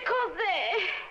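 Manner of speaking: normal talking voice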